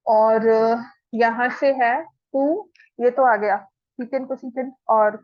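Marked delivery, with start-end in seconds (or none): none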